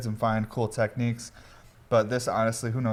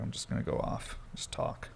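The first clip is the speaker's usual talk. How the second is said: soft unvoiced aside; half-whispered thought; 10 dB quieter than usual. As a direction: murmur it to yourself. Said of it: "so I was like".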